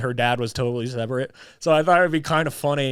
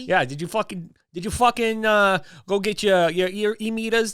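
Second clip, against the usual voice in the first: mock Brooklyn; 6 semitones higher than usual. Trading the Brooklyn bit.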